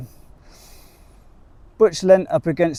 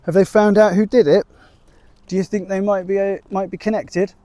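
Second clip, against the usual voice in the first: higher, louder anxious questioning; worried Trudy asking about the damage; 7 semitones higher, 6 dB louder than usual. A narrator's reading. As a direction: fret over the damage. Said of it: "with concern".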